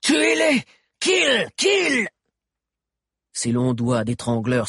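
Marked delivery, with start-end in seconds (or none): 0.1-2.1 very high, screeched commands; piercing savage war cry; cried order. A narrator's reading. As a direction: shriek the kill order in short bursts. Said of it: "cria soudain Cro-Bleu".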